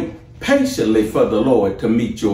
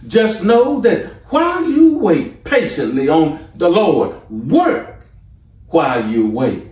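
higher, louder preaching peak; preaching climax; 5 semitones higher, 4 dB louder than usual. Acted. Preacher closing his first point, rising to a peak. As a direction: drive the point home.

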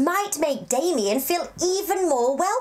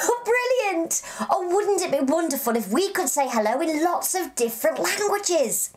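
ordinary talk, nothing marked

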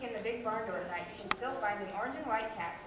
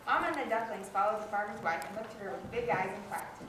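usual speaking voice

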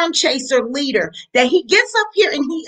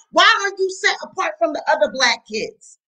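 ordinary talk, nothing marked

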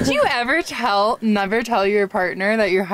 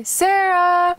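a raised, higher call across the trail; calling out a name; 8 semitones higher, 3 dB louder than usual. Retold or imitated. Boyfriend retelling how he called for his missing partner, raised call. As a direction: call her name into the woods.

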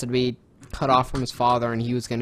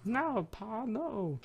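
higher, softer pleading; pleading son; 11 semitones higher, 8 dB quieter, much slower than usual. Retold or imitated. Voicing Carl pleading with his father, soft and high. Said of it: "he's like".

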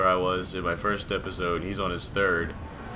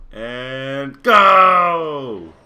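shouted, stretched-out go; big slow launching yell; long drawn-out shout; 5 semitones higher, 12 dB louder than usual. Real, not acted. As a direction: draw the last word out in a loud, high yell. Player launches a shot in game.

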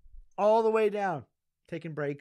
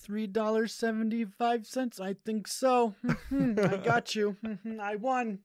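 higher, hammed-up Indian accent; Indian accent impression; 9 semitones higher, 5 dB louder, slower than usual. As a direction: play the friend haggling over prices in an Indian accent. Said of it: "that's not a good impression".

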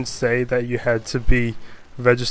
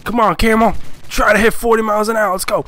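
higher-pitched playful rider urging it on; urging a camel; 7 semitones higher, faster than usual. Acted. A driver coaxing his camel along the highway, urging.

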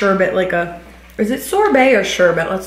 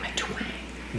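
whispered; 18 dB quieter than usual. Real, not acted. Echoing a word.

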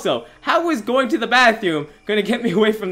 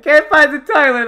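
high, loud pretend wail; mock wailing; 5 semitones higher, 7 dB louder than usual. Disappointed player hunting a toilet, mock wailing. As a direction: whine it out in mock despair.

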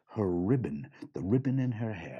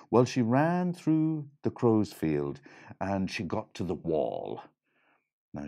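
plain reading voice

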